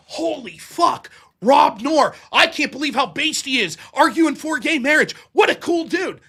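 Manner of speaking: impressed fans gushing, put on; mock awe. Rival's audience marvelling at his debating, mock awe. Voices hostile audience now admiring him.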